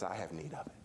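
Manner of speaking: very low and soft; quiet firm answer. Acted. Jesus repeating his claim to the congregation, quiet and firm. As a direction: close it down in a hushed voice.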